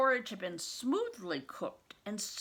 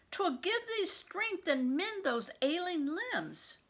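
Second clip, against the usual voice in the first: much higher pleading nurse voice; 10 semitones higher than usual. Acted. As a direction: beg him to eat. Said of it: "she begged".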